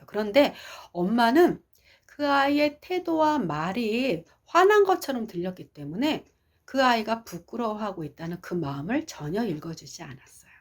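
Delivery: usual speaking voice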